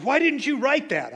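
high, loud demanding question; exasperated editor. Retold or imitated. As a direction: mimic an editor's frustrated outburst. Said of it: "finally, she said".

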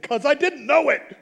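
high, very loud comeback; loud, emphatic self-defence; exasperated retort. Retold or imitated. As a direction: blurt it out in mock exasperation. Acted exasperated retort to editor.

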